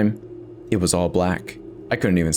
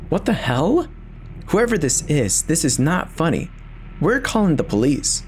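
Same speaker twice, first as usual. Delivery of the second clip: higher, sharp scolding of the prankster; wife's angry warning; 7 semitones higher than usual. Acted. Wife shouting at the unseen knocker, angry and scared. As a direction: threaten whoever is knocking.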